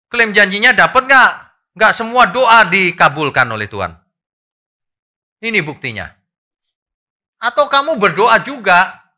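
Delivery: loud, high, emphatic sermon delivery; full-force preaching. Acted. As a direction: drive each point home to the congregation.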